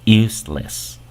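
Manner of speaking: "Useless" said in English; English "Useless", stress on use; English word. Shown as a demonstration. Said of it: "le aumentas el less". ("Useless" en inglés)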